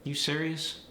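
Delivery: standard American question, held fairly level. Shown as a demonstration. Teacher giving the standard question for comparison, standard American. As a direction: neutral standard American, narrower pitch range. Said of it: "If I were to say".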